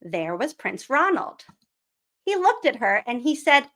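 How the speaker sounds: put-on storybook reading voice for kids; story narration. A narrator's reading. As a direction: read it like a bedtime picture book. Reading picture book aloud.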